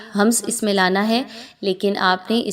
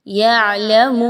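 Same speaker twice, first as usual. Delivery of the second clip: slow, louder melodic recitation; Quran recitation; 3 semitones higher, 4 dB louder, slower than usual. Shown as a demonstration. Teacher recites the verse for the class, slow and melodic. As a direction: chant the verse with long vowels. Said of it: "teacher reciting the verse".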